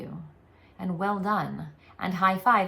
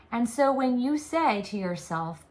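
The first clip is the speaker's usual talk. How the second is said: ordinary talk, nothing marked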